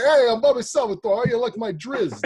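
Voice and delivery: high, loud put-on mobster voice; mobster Bobby Salvatore. Author impersonates a colleague for laughs as a mobster. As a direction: ham it up like a cartoon wiseguy. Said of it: "I do this mobster voice".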